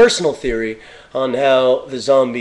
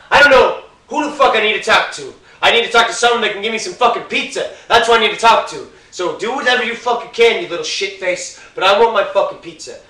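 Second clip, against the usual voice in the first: loud, higher, ranting at the cashier; angry customer roaring at the counter; furious shout; 4 semitones higher, 6 dB louder than usual. Acted. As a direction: yell it, pounding every curse. Customer rants about pizza.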